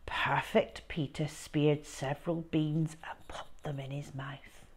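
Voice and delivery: ordinary delivery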